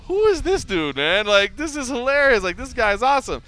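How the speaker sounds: high, loud amazed laughing tone; amused disbelief. Retold or imitated. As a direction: sound delighted and amazed. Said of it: "I'm like".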